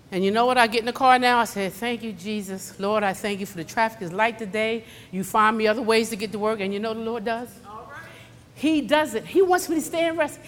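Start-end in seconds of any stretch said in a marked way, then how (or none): 1.7-6.6 soft, subdued retold thanks; quiet thankful prayer. Driver thanking Jesus for light traffic, softly grateful. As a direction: murmur a grateful prayer, imitated.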